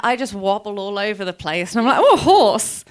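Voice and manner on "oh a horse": high, loud, giddy exclamation; delighted distraction. Acted. Rider tells of wobbling off-road, delighted yelp. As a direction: get suddenly distracted by something cute.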